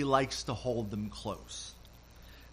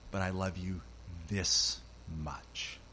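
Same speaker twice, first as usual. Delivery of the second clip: tender reply; 6 semitones lower, 6 dB quieter than usual. Retold or imitated. Father, arms wide, loving reply.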